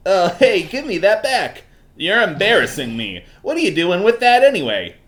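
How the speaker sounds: high, loud, flustered character voice; Larry. Acted. Game character demands his letter back, high and flustered. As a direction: whine for it back, embarrassed. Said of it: "doing the Larry voice".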